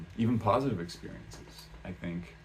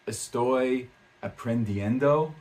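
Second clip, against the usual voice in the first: slow, higher, louder mock correction; condescending; 4 semitones higher, 5 dB louder, slower than usual. Customer correcting a learner's Spanish, condescending imitation. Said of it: "in kind of a condescending tone".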